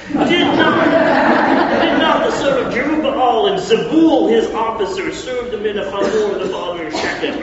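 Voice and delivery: high, loud southern braggart; southern accent. Acted. Gaal taunting the drinking lords, southern accent, high and loud. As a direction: keep the southern boast going. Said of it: "Notice how I go into southern accent".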